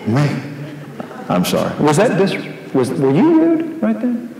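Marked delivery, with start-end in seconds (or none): 1.8-4.3 lightly voiced wife, rising higher; wife's question. Wife gently checking her husband's tone, lightly voiced and rising higher. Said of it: "my wife will say".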